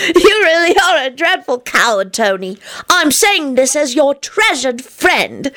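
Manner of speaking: put-on high, earnest little-kid lecture; high-pitched childish piety; pious child. A narrator's reading. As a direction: scold kindly like a sanctimonious child. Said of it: "he added piously".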